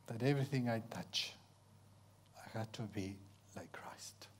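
very soft, low, near-whisper; hushed humility. Real, not acted. Preacher humbly telling his listeners his calling, hushed and low. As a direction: drop to a reverent hush.

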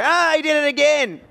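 put-on high whiny child's cry of complaint; complaining kid brother. Brother complains to Mum after a punch, high whiny wail. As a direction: squeal it like a wronged little brother. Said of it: "And they go".

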